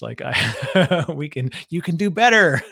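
laughing through a mock pep talk; mock coaching. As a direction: scold playfully, breaking into laughter. Mock coaching a bad pitcher.

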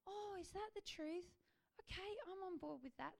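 high, soft, open-hearted voice; a heart gently giving in; lightly voiced, agreeable little heart. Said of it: "it's really soft".

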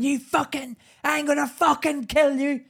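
high, furious sibling-fight shriek; imitated screaming threat. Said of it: "screaming, "Ah!" Screaming, fighting".

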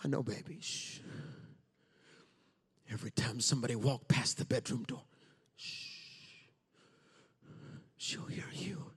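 calm the little one so she won't hear; soft hushing whisper. Acted. Rescuer soothing hidden child Joash, soft hushed whisper.

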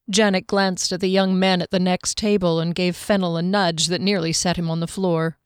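usual speaking voice

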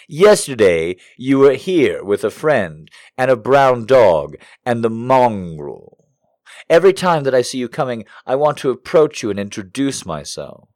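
loud, high, theatrical reading of a creepy letter; hammed-up dramatic reading voice. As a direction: read it like a melodramatic confession. Reads love letter aloud dramatically.

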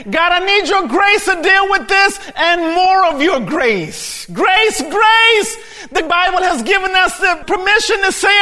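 high, loud, driving sermon delivery; full-force preaching. Acted. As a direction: preach it with rising fervor.

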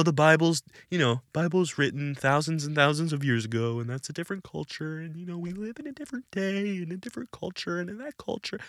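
softly mocking a modern excuse. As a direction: mutter the excuse in a dismissive mimic. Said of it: "To say, you know".

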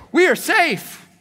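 high, loud mocking voice of the false worshippers; preacher mimics the people claiming safety, high and mocking; mock smug claim.

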